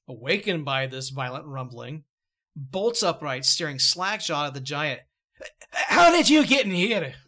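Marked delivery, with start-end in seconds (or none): 5.7-7.1 high, loud startled cry; jolted awake and alarmed; startled old Scrooge. Acted. Startled Scrooge woken by giant.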